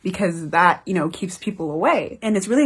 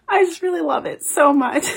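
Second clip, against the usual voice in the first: high, tearful laugh on the words; giggling while welling up; laughing through tears; 9 semitones higher than usual. Real, not acted. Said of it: "I'm gonna cry again".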